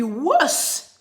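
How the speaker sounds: "worse" punched loud and high; stress on WORSE. Real, not acted. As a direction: hit the word "worse" hard. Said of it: "When I say worse, I mean worse".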